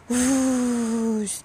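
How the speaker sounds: long, airy rush of flame; drawn-out whooshing noise; imitated whoosh. A narrator's reading. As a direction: blow the word out like fire. Imitating the dragon's puff of flames.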